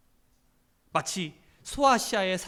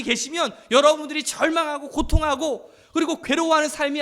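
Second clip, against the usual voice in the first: raised, emphatic sermon voice; forceful preacher's exhortation; impassioned preaching; 5 semitones higher, 6 dB louder than usual. Real, not acted. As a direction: press hope on the congregation, phrase by phrase.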